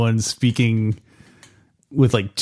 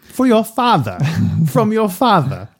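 higher, playful Italian Mama echo; mock Italian accent; 9 semitones higher, slower than usual. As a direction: toss it back as Mama, higher. Echoes Mama's accent jokingly.

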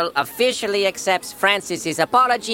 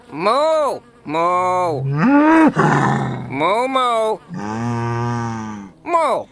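cow mooing; 3 semitones lower, much slower than usual. Acted. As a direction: moo like an angry bull. Voicing Francis the bull.

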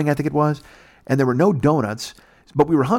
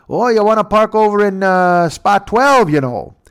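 mock border guard; 8 semitones higher, 6 dB louder than usual. Guard voiced in story.

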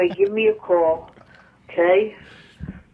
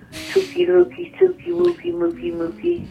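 put-on high squeaky baby-talk pet names; 12 semitones higher than usual. Voicemail sign-off to him in high baby talk.

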